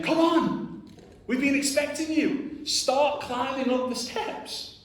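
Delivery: high, projected beckoning call; older man calling out. Acted. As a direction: call down from the top of distant steps. Older guy calling from golden steps.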